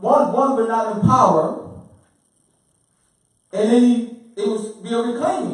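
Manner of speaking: high, loud, impassioned declaration; forceful preaching. Real, not acted. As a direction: drive the point home at full voice. Emphatic preaching to the group.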